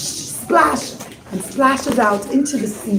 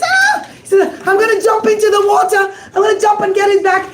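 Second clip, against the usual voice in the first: mock frantic; 8 semitones higher, faster than usual. Rabbi pretends he will dive after the diamond, frantic. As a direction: threaten to jump in, overacting.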